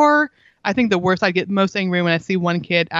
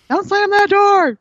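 much higher, louder, slow yelled scold; mock scolding parent; 12 semitones higher, 5 dB louder, slower than usual. Mom mimics a parent yelling at a slammed door, mock scolding. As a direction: play the fed-up parent barking at the kid. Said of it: "'cause that's another thing".

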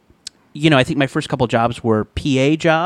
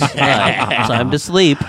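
cat voice; much slower than usual. Retold or imitated. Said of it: "she talks like this".

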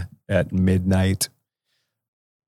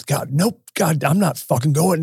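retold outburst in a raised pitch; imitated yelling; 8 semitones higher than usual. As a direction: imitate an older man's indignant refusal. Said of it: "he's yelling at the".

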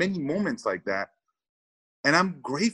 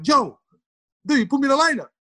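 excited; 13 semitones higher, 4 dB louder than usual. Retold or imitated. Bursting into manager's office thrilled.

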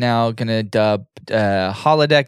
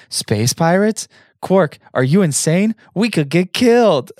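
higher, incredulous shocked questioning; playing shocked disbelief, pitched up; acted shock; 7 semitones higher, 3 dB louder than usual. A narrator's reading. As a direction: read it as a hammed-up alarmed protest. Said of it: "widens his eyes in shock".

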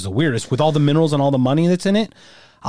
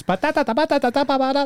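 high, choppy "ba-da-da" like a broken recording; glitchy stutter noise; faster than usual. Retold or imitated. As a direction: imitate a skipping audio glitch. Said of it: "I thought something broke on the recording".

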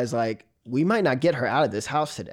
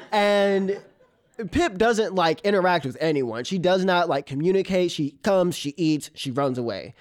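ordinary talk, nothing marked

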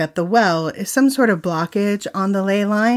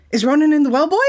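high, louder dog-owner voice; talking to a dog; 7 semitones higher, 4 dB louder, much faster than usual. Pretend. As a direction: ask it like calling to a dog. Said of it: "unless Lassie has come to get you".

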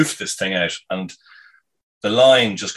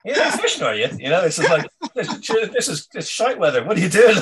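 much higher, incredulous mock voice; mocking photographer; 11 semitones higher than usual. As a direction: send up the disbelieving photographer. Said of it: "he kind of rocks up and he goes".

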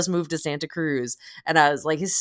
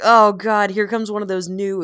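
loud, higher groan of dread at the train; mock dread; 7 semitones higher, 8 dB louder than usual. Telling a friend about the train, mock dread. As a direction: ham up the dismay.

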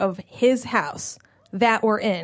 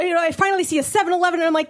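normal talking voice

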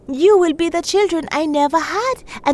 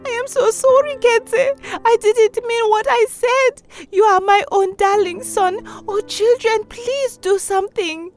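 tearful pleading; 13 semitones higher than usual. A narrator's reading. Shindo begs pumpkin forgiveness.

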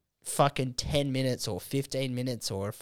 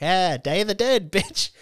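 loud, high mock jeer; hammed-up punchline yell; gleeful taunt; 7 semitones higher, 7 dB louder, slower than usual. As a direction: crow it triumphantly, pitch climbing into laughter. Acts out the joke's taunting punchline.

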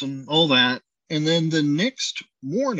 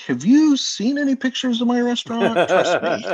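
incredulous retort; 6 semitones higher, 3 dB louder than usual. Retold or imitated. Chef incredulous at invisible crew claim.

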